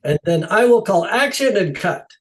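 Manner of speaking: put-on high, loud Dutch-accented proclamation. Dutch director claiming his one job, Dutch accent. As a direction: announce it grandly with a Dutch accent. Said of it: "in his Dutch accent".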